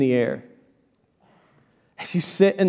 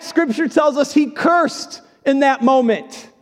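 ordinary talk, nothing marked

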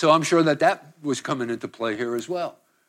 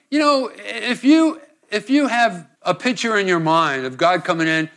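ordinary delivery

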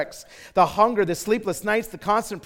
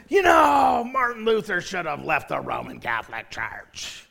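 hammed-up pronouncement sliding steadily downward; loudmouth passenger; 3 semitones lower than usual. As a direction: imitate an opinionated seatmate. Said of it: "in that same voice".

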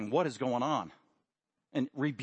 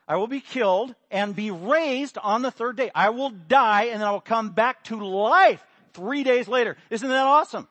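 loud, higher, eager good-news voice; excited Jesus; 6 semitones higher, 8 dB louder than usual. Acted.

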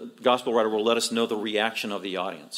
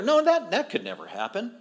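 light, higher-pitched disbelief; imitating breezy, jovial listeners; nonchalant dismissal; 8 semitones higher than usual. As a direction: wave it away as impossible. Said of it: "probably nonchalant".

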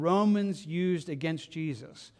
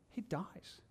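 very soft, hushed pronouncement; almost breathe the words; near whisper; 15 dB quieter than usual. Real, not acted. Solemn pause after death.

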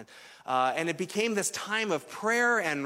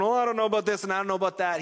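quick, dismissive mock whine of online critics; lightly mocking hand-wringing critic; mocking skeptics; 3 semitones higher, 3 dB louder, much faster than usual. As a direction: brush them off fast, imitating doubters. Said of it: "People online are going".

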